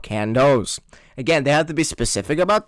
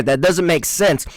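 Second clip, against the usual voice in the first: ordinary talk, nothing marked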